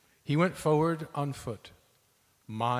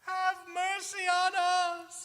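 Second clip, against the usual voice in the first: much higher, pleading cry; desperate high plea; crying for mercy. Acted. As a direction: beg the angry God for mercy. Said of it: "they cried".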